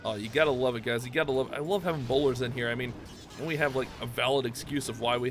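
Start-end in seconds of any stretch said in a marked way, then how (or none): none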